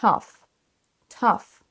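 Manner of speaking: Tough as short TUFF, ending in F; correct pronunciation. Shown as a demonstration. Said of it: "voilà comment il se prononce". (Tough prononcé teuf, voyelle brève)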